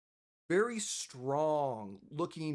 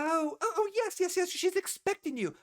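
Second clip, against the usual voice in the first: high, louder excited oh yes yes; eager recognition; 12 semitones higher, 4 dB louder than usual. Acted. Receptionist realizing the party is expected, high and eager.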